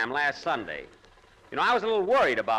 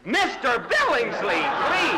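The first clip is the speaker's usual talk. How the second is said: higher, shocked reproach; scandalized rebuke; 9 semitones higher than usual. Acted. Jack scolding his passenger for flirting, shocked higher reproach. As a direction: stop him, embarrassed and prim.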